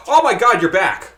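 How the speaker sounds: delighted shout of welcome; joyful reunion. Real, not acted. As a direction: greet a friend back loudly. Friend rejoins call after mic trouble.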